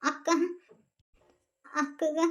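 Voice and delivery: slow, choked with tears; tearful plea. Acted. Girl begs her uncle, voice breaking with tears. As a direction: plead through crying.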